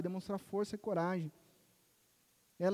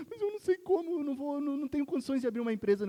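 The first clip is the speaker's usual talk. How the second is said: much higher, fast and anxious; panicky high protest; 7 semitones higher, 3 dB louder, faster than usual. Retold or imitated. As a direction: squeak out the objection in a panic. Voicing a doubting believer.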